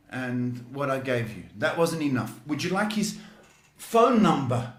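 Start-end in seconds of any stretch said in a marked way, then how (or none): 2.5-4.7 higher, louder sarcastic jab; mock the caller for wanting more. Real, not acted. Psychic teasing the caller who wants more detail, sarcastic.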